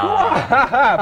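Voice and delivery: high, drawn-out exclamation; over-the-top high cry; hammed-up cry. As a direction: wail it out theatrically. Host cries out the word.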